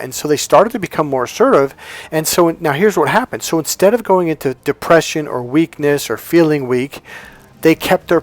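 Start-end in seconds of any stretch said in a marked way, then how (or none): none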